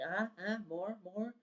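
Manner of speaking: small eager toddler noises, lightly mimicked; toddler, baby. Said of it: "like a baby".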